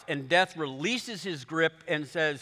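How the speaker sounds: ordinary talk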